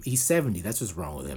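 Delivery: ordinary talk, nothing marked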